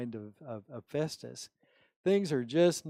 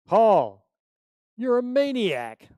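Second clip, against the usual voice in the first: much higher, much louder outraged yell; shouting; 8 semitones higher, 11 dB louder, slower than usual. Retold or imitated. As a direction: yell at him as a madman. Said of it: "he shouted".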